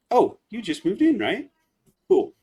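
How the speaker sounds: Sebastian retake. Acted. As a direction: pitch it up, curious and rising.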